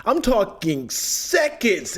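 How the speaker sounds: ordinary talk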